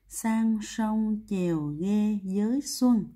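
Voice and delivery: very slow, word by word; slow recitation. Shown as a demonstration. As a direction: recite the memory phrase with each word drawn out. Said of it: "Cô đọc lại". (đọc thật chậm từng chữ)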